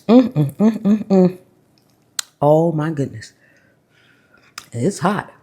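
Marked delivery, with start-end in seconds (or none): 0.1-1.4 savoring hum. Real, not acted. Tasting, savoring.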